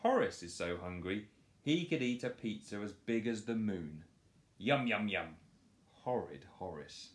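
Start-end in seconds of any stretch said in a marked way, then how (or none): none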